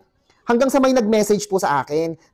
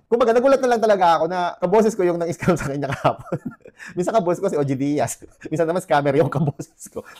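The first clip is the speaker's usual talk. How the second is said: laughing. Real, not acted. Laughs about voice resemblance.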